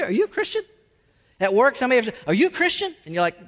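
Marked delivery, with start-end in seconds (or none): 0.0-0.6 much higher, rising question; nosy questioner. Acted. Someone at work asking about faith, high-pitched and probing. Imagined coworker questions believer. 2.3-2.9 high-pitched rising question; nosy questioner. Acted. Coworker putting a believer on the spot, high and questioning.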